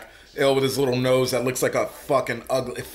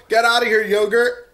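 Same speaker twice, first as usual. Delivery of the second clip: higher and louder, playful "get outta here"; mock yell; 6 semitones higher, 4 dB louder than usual. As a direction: throw the line out in a hammed-up shout. Repeating goat line, louder.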